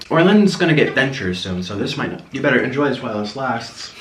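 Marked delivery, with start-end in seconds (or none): none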